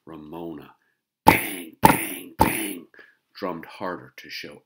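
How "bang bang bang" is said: very loud banging sound effect; loud bangs. Acted. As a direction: hammer the bangs out. Drumming heels.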